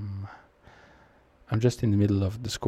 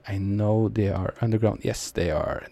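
ordinary talk, nothing marked

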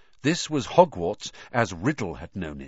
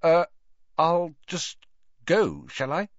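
hesitant, broken by pauses; stammering; 5 semitones higher than usual. A narrator's reading. Harry awkwardly excuses himself to the wizard, stammering. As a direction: falter between words, unsure whether to stay. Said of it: "and stammered".

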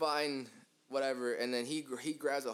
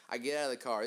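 ordinary delivery

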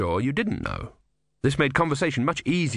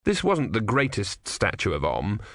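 ordinary delivery, nothing marked

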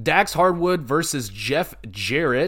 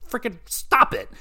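incredulous; 9 semitones higher than usual. Real, not acted. Marvels at wrestler's age.

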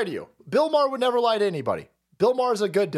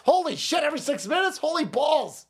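ordinary talk

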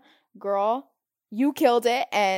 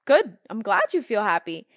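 surprised; 11 dB louder than usual. Retold or imitated. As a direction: act surprised at the good news. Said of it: "They're like".

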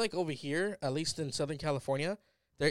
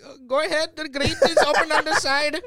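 put-on high, loud Indian-accented housewife; thick Indian accent; 12 semitones higher, 9 dB louder than usual. Wife directing the service worker at the door, thick Indian accent. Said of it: "his wife is the same way".

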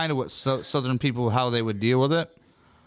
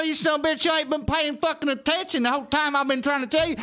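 high-pitched Southern rant; hammed-up Southern scolding, voice pushed way up; Southern accent. Shown as a demonstration. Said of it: "that's Kentucky or North Carolina".

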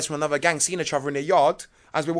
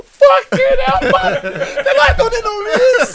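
much higher, louder laughing exclamation; excited lads; 10 dB louder, much slower than usual. Retold or imitated. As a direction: burst out like amazed lads. Irish lads react loudly.

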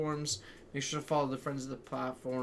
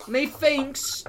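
drawn-out high methinks; mock British accent; 11 semitones higher, 6 dB louder than usual. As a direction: stretch out Methinks like a pantomime villain. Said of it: "in that same voice".

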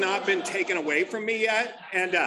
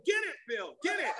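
high, soft mock cheering; pitched-up pretend urging; Bob egging Bill on; 8 semitones higher, 5 dB quieter than usual. Comic voicing Bob winking at Bill, high mock cheer. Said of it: "you just see Bob going".

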